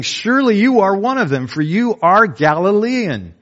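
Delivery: high, loud accusing cry. Acted. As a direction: point the finger and charge him. Crowd accusing Peter.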